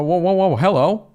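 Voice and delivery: ordinary talk, nothing marked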